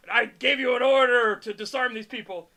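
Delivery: loud officer's rebuke; mock commanding anger. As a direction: bellow it like an outraged commander. Acted officer scolding a soldier.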